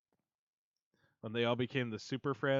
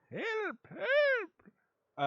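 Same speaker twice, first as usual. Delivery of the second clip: high loud playful yelp; mock cry for help; 6 dB louder than usual. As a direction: squeal for help, joking.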